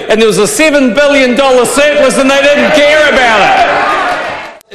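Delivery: loud heated debating shout; shouting angrily. Real, not acted. Heated reply in parliament.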